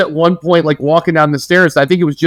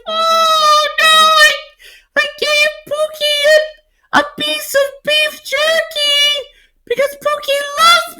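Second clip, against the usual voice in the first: slow falsetto sobbing impression, building to a wail; hammed-up distraught mother, very high and drawn out; crying, ridiculous high voice; slower than usual. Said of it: "crying, and she has this ridiculous voice".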